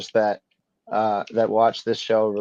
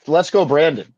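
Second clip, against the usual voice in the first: raised, punchy mock chant of the slogan; 6 semitones higher, 5 dB louder than usual. Host answering the equity report with a mock chant. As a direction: fire it back like a taunt. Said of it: "what I have to say to that is".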